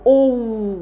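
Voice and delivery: "او" as a long, stretched "oo"; drawn-out OO. Shown as a demonstration. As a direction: hold the vowel of "او" slowly, like a double O. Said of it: "كانه مد بالواو". (مد "او" بالواو)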